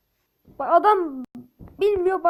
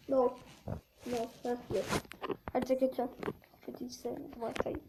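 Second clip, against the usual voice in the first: ordinary delivery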